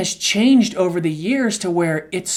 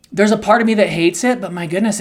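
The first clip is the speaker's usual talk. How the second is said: ordinary talk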